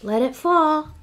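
lively picture book read-aloud; reading to children. Acted. Teacher reading a picture book aloud to her class. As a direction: read it like story time for little kids.

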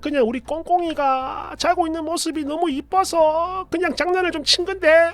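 put-on high, soft pleading older-woman voice; apologetic mother. Mother begs daughter to let it go, high soft apology. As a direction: plead meekly, drawing out each phrase. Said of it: "장모님은 미안하다고 계속 사과도 하셨어요".

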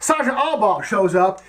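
usual speaking voice

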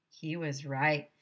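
plain reading voice

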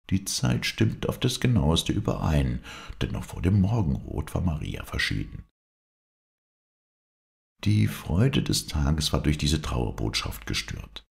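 ordinary delivery, nothing marked

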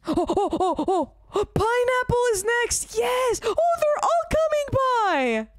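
high, loud thrilled cries; excited shouting. Real, not acted. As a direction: shout with joy. Characters arrive for a performance.

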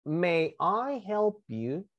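"May I help you?" said slowly, word by word; slow, careful teacher's model of "May I help you?"; pronunciation model. Shown as a demonstration. Teacher models the seller's question for students, slow pronunciation demo. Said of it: "por favor, a la pronunciación".

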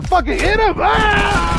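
high, loud yell into a scream; frantic shout. Real, not acted. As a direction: scream the order in panic. Frantic moment in the game.

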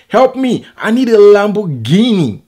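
loud, high-pitched mock cry for help; imitated yelling. Vlogger mimics the man who kicked Arnold Schwarzenegger, yelling. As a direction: yell it out, mimicking the man's desperate plea. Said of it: "He was yelling".

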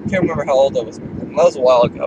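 usual speaking voice

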